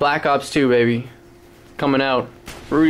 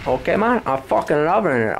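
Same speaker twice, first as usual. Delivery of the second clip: put-on Scarface accent; 6 semitones higher than usual. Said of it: "I can't do the accent so good".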